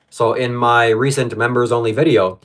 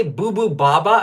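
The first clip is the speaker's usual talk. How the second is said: silly nonsense words; 6 semitones higher, slower than usual. Pretend.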